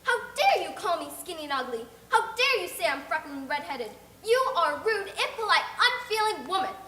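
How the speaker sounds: loud, high, indignant tirade; furious child. Acted. Insulted girl raging at the neighbour, loud and indignant. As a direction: shout down the rude woman.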